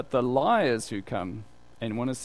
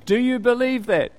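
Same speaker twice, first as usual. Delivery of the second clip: "Do you believe that?" pitched high and loud; pointed question; 9 semitones higher, 6 dB louder than usual. Retold or imitated. Jesus asking Mary "Do you believe that?", pointed high question. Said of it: "he asked her the question".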